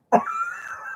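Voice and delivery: a sudden high squeal of a laugh; high burst of laughter. Real, not acted. Laughing at the friend's mock scolding, high squealed laugh.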